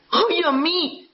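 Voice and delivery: a mock wife whining to her counselor; wife's high whine. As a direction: squeak it out like a put-upon wife. Counselor voices wife in session.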